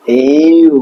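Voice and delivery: "ইউ" held long in a smooth arc; long U glide. Shown as a demonstration. Teacher showing learners the letter U, drawn-out "ইউ". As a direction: stretch "ইউ" slowly from ee into oo. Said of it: "এভাবে, হ্যাঁ".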